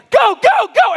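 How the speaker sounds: very high and loud frantic go go go; panicked yelling. Retold or imitated. Guys fleeing the shark on floaties, frantic high yell. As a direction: yell at everyone to paddle for their lives.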